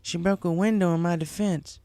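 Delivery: a friend admitting how much she cares; Applejack's voice. Acted. Applejack confiding in Twilight, lightly voiced earnest.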